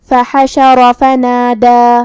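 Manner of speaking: slow chant of فحشر فنادى with long held final a; lengthened madd. Shown as a demonstration. Teacher demonstrates the madd, فحشر فنادى with drawn-out ending. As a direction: keep short vowels even, stretch the long vowels of فنادى. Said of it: "اپنی آواز دو مد کے برابر لمبی کریں گے".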